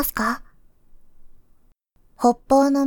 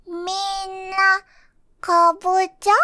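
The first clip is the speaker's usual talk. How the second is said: put-on high, delighted little kid; a toddler amazed at all the pumpkins; small child's voice; 6 semitones higher than usual. (高い声ではしゃぐ幼児)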